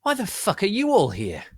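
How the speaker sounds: high, loud demand; startled shout. Acted. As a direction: shout at the intruders. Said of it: "I yielded".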